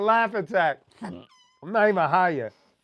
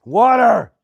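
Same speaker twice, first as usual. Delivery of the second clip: shouting; 3 semitones higher, 10 dB louder than usual. Real, not acted. Hiccups, calls for water.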